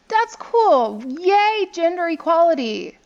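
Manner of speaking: loud, high-pitched cheering; celebratory whoop of approval; excited cheer. Real, not acted. Cheers woman knight card.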